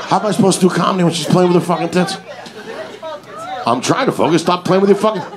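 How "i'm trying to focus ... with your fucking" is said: ordinary talk, nothing marked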